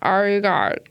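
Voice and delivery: broad Aussie cadence; Dan Towns impression. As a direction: put on an Ocker Australian drawl. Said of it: "I do do a good Dan Towns".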